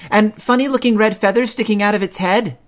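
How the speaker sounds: high, loud little bear cub; eager cub. A narrator's reading. Brother Bear starts the whopper for Mama, eager. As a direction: pipe up like a fibbing child.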